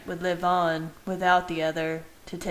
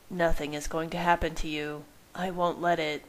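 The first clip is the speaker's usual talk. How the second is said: ordinary delivery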